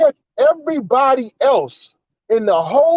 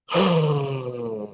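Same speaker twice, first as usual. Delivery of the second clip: weary sigh; 7 semitones lower, 7 dB quieter than usual. Real, not acted. Exasperated sigh.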